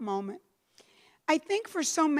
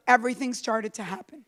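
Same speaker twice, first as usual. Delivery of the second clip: ordinary talk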